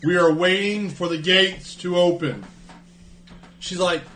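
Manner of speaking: ordinary talk, nothing marked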